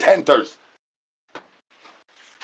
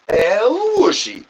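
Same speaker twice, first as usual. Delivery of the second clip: slow, higher catchphrase; higher drawn-out call; 9 semitones higher than usual. Pretend. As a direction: lift it and stretch it.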